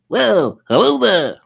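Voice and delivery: high, loud hooting greeting; Rob. Acted. Host shows what Rob sounds like, high loud impression. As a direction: hoot it out in a high impersonation. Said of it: "We all know what Rob sounds like".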